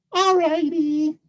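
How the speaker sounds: put-on very high, slow little-girl voice; obliging little girl. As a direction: draw it out in a tiny high voice.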